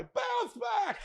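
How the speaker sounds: high-pitched yell of a nickname; shouted greeting. Retold or imitated. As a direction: call it out like a kid passing by. Kid shouts from passing car.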